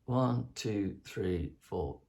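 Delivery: counting with the beat pushed early. Shown as a demonstration. Composer showing musicians a pushed count. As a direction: count it, leaning ahead of the beat. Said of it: "you go".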